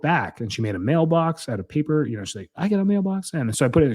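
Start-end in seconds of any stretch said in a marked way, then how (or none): 2.6-3.3 higher-pitched, lightly imitated excited kid; excited child. Daughter showing off what she made, mildly mimicked excitement. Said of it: "She was so excited".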